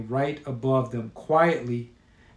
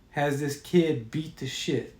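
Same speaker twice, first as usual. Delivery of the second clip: plain reading voice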